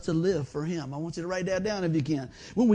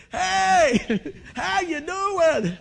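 a high, loud, slow greeting with a laugh; dad talking louder; 10 semitones higher, 6 dB louder, much slower than usual. Retold or imitated. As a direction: boom out a hearty hello, laughing. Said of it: "he's talking louder".

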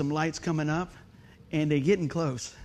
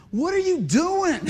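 high, slow accusing question; imitating friend; 11 semitones higher, 6 dB louder, slower than usual. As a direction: mimic her startled accusation. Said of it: "the first thing she said is like".

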